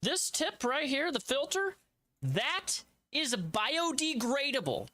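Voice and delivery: hammed-up sponsor pitch; upbeat salesman voice; ad read.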